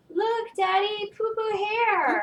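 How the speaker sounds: put-on high, slow little-girl voice. Retelling the little girl to friends, high and slow. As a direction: sound like a small child pointing. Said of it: "she was like".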